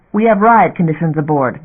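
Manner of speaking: ordinary delivery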